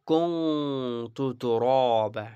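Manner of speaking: কুনতু drawn out with the nasal hum of a Quran teacher; nasal ghunnah demo. Shown as a demonstration. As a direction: hold the ন in কুনতু through the nose, slowly. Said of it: "নাকের মধ্যে করব". (কুনতু নাকের গুন্নাহ দিয়ে টেনে পড়া)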